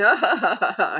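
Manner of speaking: a fresh burst of giggling; bubbling laughter. Shown as a demonstration. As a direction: let the laugh bubble back up. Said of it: "one person might start bubbling up again".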